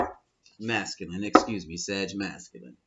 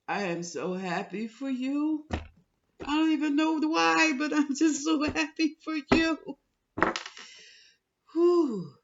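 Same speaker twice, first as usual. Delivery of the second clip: very high, loud, slow happy cry; tearful high-pitched delight; overjoyed, near tears; 4 dB louder than usual. Real, not acted. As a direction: pour out real joy, voice breaking high. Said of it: "Let me get myself together".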